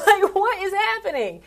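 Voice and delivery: high laughing bewilderment. Real, not acted. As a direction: laugh it out in disbelief. Laughing at friend's preacher voice.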